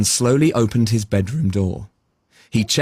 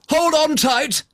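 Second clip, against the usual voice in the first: high cry of Hold on tight; yelling; 14 semitones higher, slower than usual. Acted. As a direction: call it out as you take off. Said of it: "was yelling".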